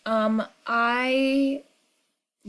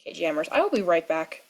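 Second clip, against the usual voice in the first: ordinary talk, nothing marked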